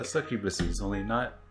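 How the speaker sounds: usual speaking voice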